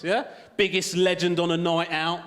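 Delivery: ordinary talk, nothing marked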